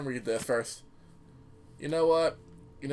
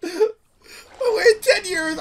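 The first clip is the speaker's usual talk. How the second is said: mock sobbing; 14 semitones higher, 8 dB louder, much slower than usual. Mock despair over game wait.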